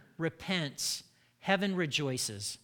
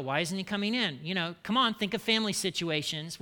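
usual speaking voice

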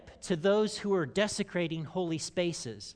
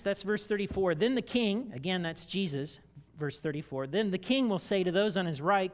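ordinary delivery

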